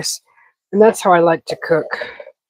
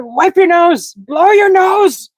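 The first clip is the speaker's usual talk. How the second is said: high, loud, slow mock command; retold shout; 11 semitones higher, 5 dB louder, slower than usual. Friend yelling at the sniffling streamer, mock shouted. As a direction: bark the order to blow your nose. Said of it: "He said".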